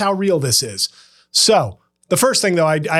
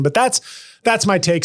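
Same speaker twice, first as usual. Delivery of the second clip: ordinary talk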